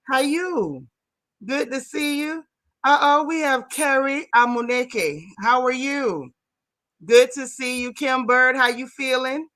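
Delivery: ordinary delivery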